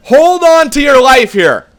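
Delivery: slow, loud and high; rally yourself like a commentator; loud, drawn-out urging; hyped warning. Real, not acted. Tense third period.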